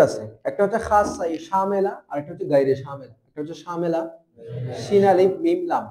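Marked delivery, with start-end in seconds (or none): none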